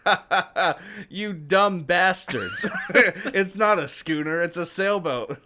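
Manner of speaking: put-on laughs at the kids, slow and loud; mocking laugh. The sailboat guy mocking the kids, laughing taunt. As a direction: cackle through the taunt, drawn out. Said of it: "he goes".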